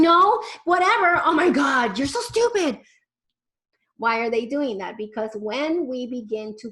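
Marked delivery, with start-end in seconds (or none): 0.0-2.8 high, loud mocking teen voice; whiny sneering teenager, louder and higher; mock bratty teen. Parent mimicking a rude teen talking back, bratty and loud.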